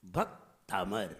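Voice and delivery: "भक्तामर" low and slow, split "भक्; unforced correct pronunciation. Shown as a demonstration. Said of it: "जोर नहीं लगाइए".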